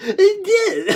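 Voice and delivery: high squeak of laughing tears; cracked, high laugh-cry. Real, not acted. Helpless laughter.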